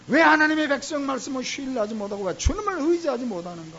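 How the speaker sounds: a pastor's fervent sermon climax; full-force preaching. Real, not acted. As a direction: drive it home to the congregation like a revival preacher. Rebuking congregation.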